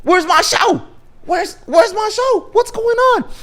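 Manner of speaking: very high-pitched cartoon whine; squealing, upset kid impression; Cartman. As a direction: wail it in mock distress. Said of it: "And then Cartman's like".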